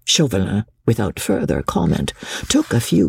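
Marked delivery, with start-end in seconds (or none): none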